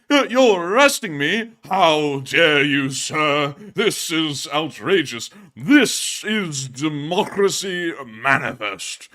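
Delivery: loud, slow, booming oratory; hammed-up indignant arrestee; theatrical outrage. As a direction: declaim it like an affronted stage actor. Acting out a famous arrest rant.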